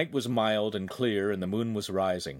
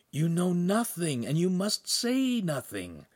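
high, slow, drawn-out warning; warning servant; 9 semitones higher, slower than usual. A narrator's reading. Servant urgently cautioning his master.

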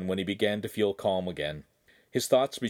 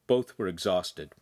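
ordinary delivery, nothing marked

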